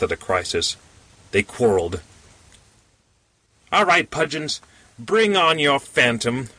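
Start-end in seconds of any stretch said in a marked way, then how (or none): 3.7-6.5 high-pitched, mildly defiant cry; cried challenge. A narrator's reading. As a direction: throw down the challenge in a raised pitch. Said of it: "cried the little man in conclusion".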